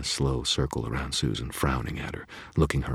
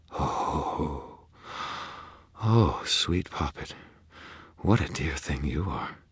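breathy delight; slower than usual. Acted. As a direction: swoon over the words. Leah shivers with delight.

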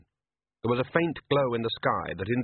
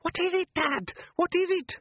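put-on high frightened boy's voice; a scared son pleading. A narrator's reading. Son Les asking his father in fear, high frightened boy voice.

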